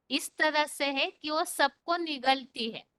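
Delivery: ordinary delivery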